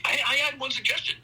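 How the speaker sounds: high-pitched, stammered mock excitement; excited reaction. Acting out his excited reaction.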